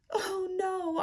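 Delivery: high, soft whimpered dismay; small, delicate little cry; mild dismay. Shown as a demonstration. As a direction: demo of restrained upset. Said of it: "I'm not talking like".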